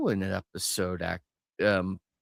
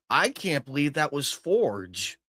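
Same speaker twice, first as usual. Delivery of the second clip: ordinary talk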